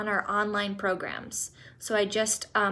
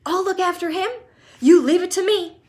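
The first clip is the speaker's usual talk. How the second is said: high, loud and insistent; eager shout; 9 semitones higher, 10 dB louder than usual. Acted. Grandpa Joe insisting he takes Charlie, high loud shout. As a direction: claim the job with joy. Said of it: "shouted Grandpa Joe".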